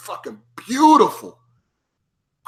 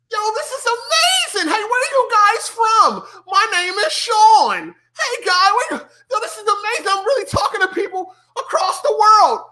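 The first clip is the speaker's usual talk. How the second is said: much higher, wide-eyed and thrilled; giddy first-time gamer; 13 semitones higher than usual. Retold or imitated. Greeting strangers in his first online lobby, overjoyed. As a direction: squeal with newbie wonder at the lobby. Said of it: "I was like".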